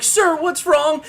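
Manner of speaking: loud, high, drawn-out question; urgent stranger. Acted. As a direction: urgently question a man who can't answer. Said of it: "They're like".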